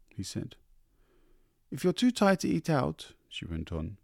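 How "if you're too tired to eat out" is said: high, light wife's voice; coaxing wife. A narrator's reading. Wife coaxing husband about supper, high. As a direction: coax him gently upward.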